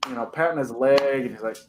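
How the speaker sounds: usual speaking voice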